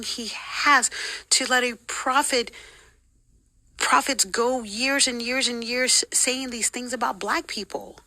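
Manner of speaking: high, slow, emphatic protest; pained disbelief. Retold or imitated. Protesting to church leader about prophets, pained disbelief. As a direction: drag out each point in disbelief. Retold protest that God hates them.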